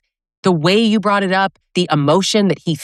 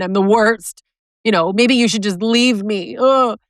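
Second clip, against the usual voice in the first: higher, whiny self-pity voice ending in a groan; self-pitying abuser; 5 semitones higher than usual. Retold or imitated. Host mocks an abuser's fake remorse, higher whiny pity. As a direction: ham up a guilt-tripping pity act. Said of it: "the guys that are like".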